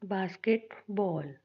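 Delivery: Basketball slowly, with pauses between its parts; split into syllables. Shown as a demonstration. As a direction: break basketball up, a gap before बॉल. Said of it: "syllables कैसे अलग करेंगे".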